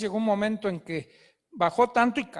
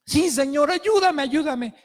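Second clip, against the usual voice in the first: much higher, loud, eager cries; relieved pleading climber; 4 semitones higher, 5 dB louder than usual. Acted. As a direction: grab at rescue with joyful desperation. Climber begs God.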